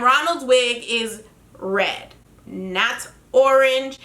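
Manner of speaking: slow, every word landed; slow and pointed, red and not orange; slow emphatic correction. Real, not acted. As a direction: spell it out, fed up. Correcting the clown insult.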